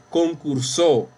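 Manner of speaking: concursó said concur-SÓ, weight on the ending; stress on last syllable. Shown as a demonstration. Teacher showing class a wrong reading, concursó stressed on SÓ. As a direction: land hard on SÓ in concursó. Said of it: "aquí no dice".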